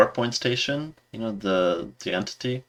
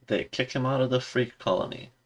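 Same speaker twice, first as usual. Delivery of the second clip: ordinary talk, nothing marked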